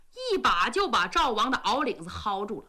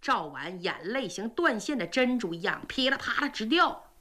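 plain reading voice

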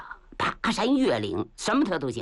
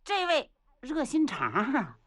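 ordinary delivery, nothing marked

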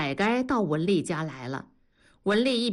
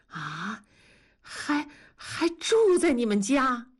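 higher, slow and halting in shock; startled; 5 semitones higher, slower than usual. Acted. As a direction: stumble over it in disbelief. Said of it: "文丽吃了一惊". (吃惊地结巴追问)

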